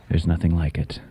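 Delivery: usual speaking voice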